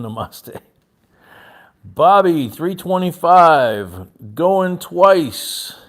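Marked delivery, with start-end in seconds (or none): 1.8-5.7 loud, drawn-out bid call; auctioneer call. Acted. Auctioneer calling bids.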